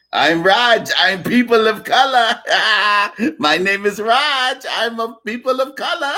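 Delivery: high, put-on Punjabi accent with laughs breaking in; mock Punjabi accent. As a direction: mimic an Indian guy in a Punjabi accent, mocking. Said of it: "in a Punjabi accent".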